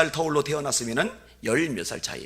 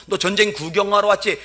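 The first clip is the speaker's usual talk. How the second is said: louder taunt; 8 semitones higher, 8 dB louder than usual. Retold or imitated. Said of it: "염장 지른 소리".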